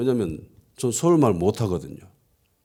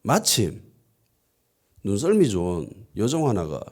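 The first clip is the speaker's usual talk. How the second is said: ordinary talk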